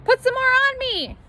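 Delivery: put-on high, loud child's plea; a small boy begging to be flattened; little boy voice. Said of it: "said Arthur".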